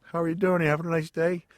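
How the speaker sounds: high, loud put-on character voice; Cactus Jack. Wrestler staying in character at girlfriend's door, putting on his character voice. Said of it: "I get into character".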